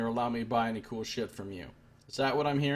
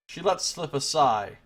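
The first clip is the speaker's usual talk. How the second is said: ordinary talk, nothing marked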